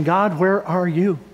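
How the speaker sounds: imitating a desperate plea, rising on the last where; pleading cry to God. Pastor voicing a sick man pleading with God. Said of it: "he's really asking".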